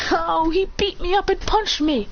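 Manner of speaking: high put-on girl's complaint; whiny victim. Girl accusing him after the fight, whining. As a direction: pitch it up and play hurt. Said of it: "She goes".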